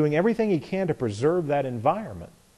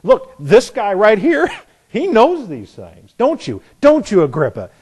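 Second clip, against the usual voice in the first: excited appeal; 6 semitones higher, 7 dB louder than usual. Acted. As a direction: point at the king and call on him. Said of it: "Festus just said".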